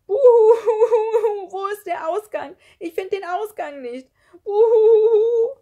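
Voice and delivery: very high, loud ghostly howl; wailing. A narrator's reading. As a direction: wail like a lost, sobbing ghost. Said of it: "Er war wirklich am Heulen". (laut und hoch heulend)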